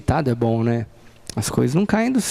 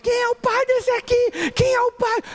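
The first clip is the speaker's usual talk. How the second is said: calling mother. Retold or imitated. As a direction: call out looking for the boy's dad.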